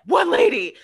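high, loud panicked "What lady?"; freaking out. Retold or imitated. Babysitter freaking out about the lady, loud panicked cry. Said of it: "she starts freaking out".

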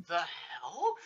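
soft, rising, baffled question; mock bafflement. Left standing at the dance, quietly baffled. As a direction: let the disbelief rise quietly. Stunned by friend's words.

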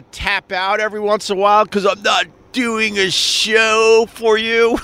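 hammed-up protest at a viewer; mock exasperation. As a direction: push it up high and stretch the words indignantly. Mock outburst at a complaining viewer.

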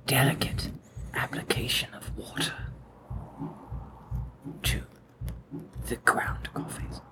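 very soft ASMR whisper. Acted. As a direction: barely breathe the words. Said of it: "A S M R".

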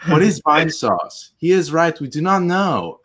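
high halting Tommy Wiseau voice; Tommy Wiseau impression. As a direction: do Tommy Wiseau reading a text. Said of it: "like, Tommy Wiseau from The Room".

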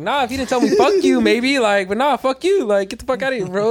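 retold yell. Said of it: "I'm like".

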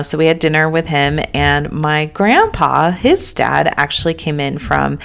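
normal talking voice

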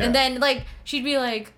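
usual speaking voice

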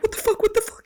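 high-pitched retold panic; mock-frantic disbelief, pitched right up. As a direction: replay being desperate and locked out. Retells panic at being locked out.

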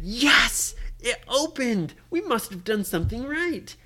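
high, jubilant outburst; excited cheer. Acted. Trapped boys see the door slide open, excited cheer.

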